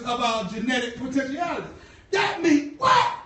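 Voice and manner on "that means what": throws the question at the church; preacher's challenge. Acted.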